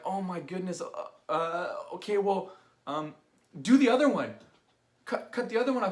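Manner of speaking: high, loud rattled decision; flustered resignation. Retold or imitated. Todd tells his buddy to cut the other side too, high rattled voice. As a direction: blurt it out, stunned but decided.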